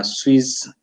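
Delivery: ordinary talk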